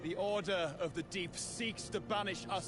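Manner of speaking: high, ringing proclamation; defiant declaration. Acted. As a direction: declare it to your enemies.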